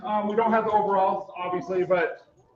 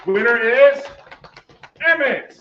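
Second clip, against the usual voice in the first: loud, raised announcer call; winner announcement; 4 semitones higher, 8 dB louder, slower than usual. Real, not acted. Host announcing the kids' winner after drum roll, loud announcement.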